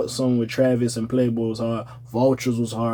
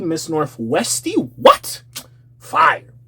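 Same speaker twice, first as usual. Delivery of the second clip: hype voice; much slower than usual. Pretend. Hyping up North West.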